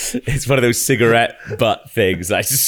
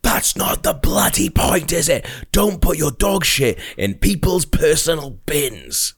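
mild mock yell; 5 semitones higher, slower than usual. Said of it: "full on screaming in my face".

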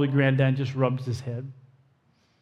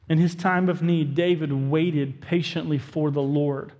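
normal talking voice

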